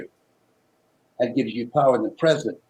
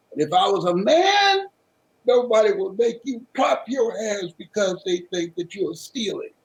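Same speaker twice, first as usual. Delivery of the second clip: raised, crying plea that falls away; tearful plea; 8 semitones higher than usual. Retold or imitated. Son crying to his mother, voice breaking. Boy pleads, tears in story.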